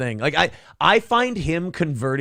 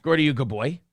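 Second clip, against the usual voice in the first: cooing at the dog; faster than usual. Real, not acted.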